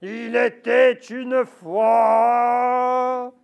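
very slow, loud, grave wolf reading; deep wolf voice. Acted. The wolf starts his scary story for the mouse, deep and slow. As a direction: drag it out in your darkest wolf voice. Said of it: "Il prend sa voix de loup, la plus grave".

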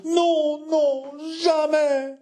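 high, drawn-out wail; sobbing refusal. Acted. Martyred wife refusing to sign, sobbing refusal. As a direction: wail the refusal, stretching the last word. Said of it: "sanglota l'épouse martyre".

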